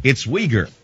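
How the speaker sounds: "It's Uyghur" quietly modeled as wee-gur; correct pronunciation. Shown as a demonstration. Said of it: "No, not Uyghur".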